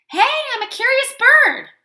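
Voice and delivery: high, loud cheerful squeak; chirpy Duckling. Acted. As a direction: sound brightly innocent. Duckling voiced.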